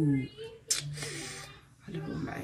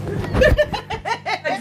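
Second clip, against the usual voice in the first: bursting into high laughter; squealing laugh; 13 semitones higher, 3 dB louder than usual. Real, not acted. Laughing at own accent.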